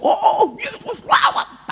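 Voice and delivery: high, loud crying-out prayer; fervent prayer. Retold or imitated. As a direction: cry out to Jesus over the patient. Retells his first healing prayer.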